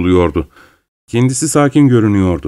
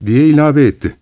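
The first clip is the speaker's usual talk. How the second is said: normal talking voice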